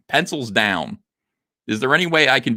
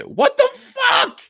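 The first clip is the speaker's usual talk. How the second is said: squeaky mock outrage; much slower than usual. Mocking a fan's disillusioned whine.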